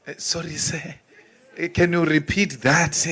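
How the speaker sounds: imitating a timid, deferential plea; mock submissive. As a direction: shrink down and ask politely. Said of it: "this submissive attitude".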